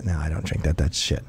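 a hint of a gruff ex-Marine; lightly voiced gruff guy. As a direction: brush it off flatly like a tough guy. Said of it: "he goes".

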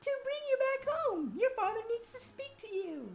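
high female character; high falsetto voice. Acted. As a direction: voice her high and gentle. Voicing character in cutscene.

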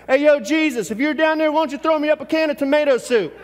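high, loud call down the stairs; a little boy hollering down to the basement for soup; yelling downstairs. Retold or imitated. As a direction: shout it down into the basement. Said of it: "he yells downstairs".

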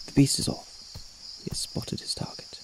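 ordinary delivery

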